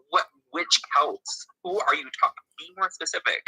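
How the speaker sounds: mock interrogation of a vague claim; exasperated challenge. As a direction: demand answers from an imagined know-it-all. Mocking vague claims about Celts.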